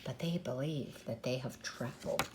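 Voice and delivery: soft, low conspiratorial whisper. Acted. Storyteller whispers the truth about the park to the children. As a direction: let the children in on the secret.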